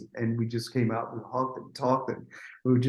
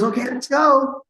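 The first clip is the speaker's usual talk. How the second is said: high, eager imitation; mock raring to go; 10 semitones higher, 4 dB louder than usual. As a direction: announce it in a put-on high voice. Acting Whitney back from tea break.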